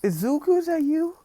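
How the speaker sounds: stammered, climbing to a high squeak; mock tearing up. Aunt recognising a boy thought dead, mock tearful stammer. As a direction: act overcome, voice rising with each try. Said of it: "she starts tearing up, like".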